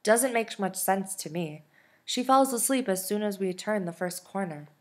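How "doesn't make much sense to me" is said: ordinary delivery, nothing marked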